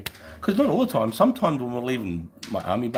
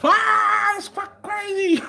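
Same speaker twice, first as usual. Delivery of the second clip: thrilled American; 5 dB louder, slower than usual. Retold or imitated. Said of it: "Yanks come up to me".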